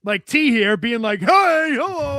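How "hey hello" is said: high, loud mock hello; mock greeting call. Giving an example of someone cutting in, mock high greeting call. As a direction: call it out high, imitating an interrupter.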